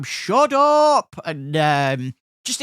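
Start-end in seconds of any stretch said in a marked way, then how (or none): 0.0-1.2 high, loud heckle from down the tram; shouting. Retold or imitated. Passengers yelling at a man who keeps talking, shouted. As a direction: yell it like an annoyed passenger. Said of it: "people were shouting from further back".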